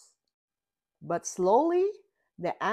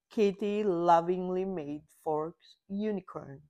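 ordinary delivery

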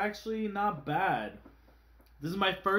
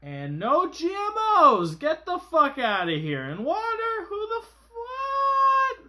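loud, high, incredulous outburst; hammed-up indignant yell; mock outrage; 11 semitones higher, 6 dB louder than usual. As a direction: rant in disbelief at the label.

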